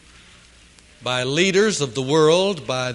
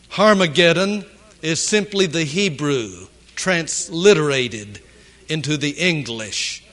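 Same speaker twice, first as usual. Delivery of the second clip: ordinary talk, nothing marked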